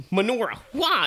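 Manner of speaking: high-pitched attack call; mock battle cry. Bear whipping out a weapon, high battle cry. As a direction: shout it like a cartoon weapon move. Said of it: "he starts pulling out his weapons".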